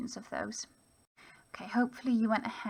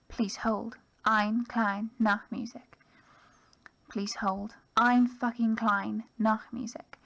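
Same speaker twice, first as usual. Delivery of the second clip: the poem read aloud, louder, with steady emphasis; 5 dB louder than usual. Acted. Teacher reading O'Driscoll's poem to students, a performed reading. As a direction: perform the poem for the class, deliberately.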